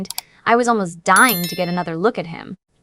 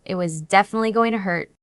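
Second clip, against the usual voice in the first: ordinary delivery, nothing marked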